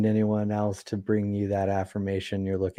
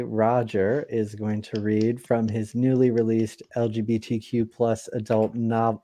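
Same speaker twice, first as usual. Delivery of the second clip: ordinary delivery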